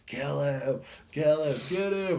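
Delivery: loud high cheering yell, kill him; yelling at the match. Retold or imitated. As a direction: fan screaming at wrestlers from the crowd, loud cheer. Cheering wrestler at live show.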